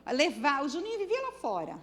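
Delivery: ordinary delivery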